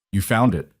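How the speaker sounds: cold, machine-like caller; robotic phone voice, no warmth; mechanical voice. A narrator's reading. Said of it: "The mechanical voice".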